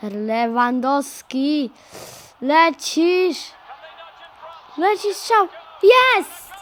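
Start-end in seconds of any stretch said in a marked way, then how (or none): 2.4-6.5 rising cheer into a shout; excited. Real, not acted. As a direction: build up and yell the goal. Player scores a goal.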